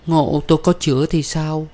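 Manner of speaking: high-pitched anxious woman's question; high, fretful plaint; tearful worry. A narrator's reading. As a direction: ask fearfully through acted tears. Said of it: "thúc thích qua nước mắt".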